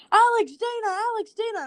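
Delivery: put-on high, loud little-girl squeal of the name; imitating a star-struck kid calling out; excited little girl. Girl spotting Dana in Walmart, high excited call. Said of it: "a little girl comes up and says".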